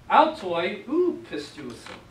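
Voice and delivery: slow careful Greek phrase; teacher reading Greek aloud. Shown as a demonstration. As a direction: read each Greek word slowly. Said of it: "They do not believe".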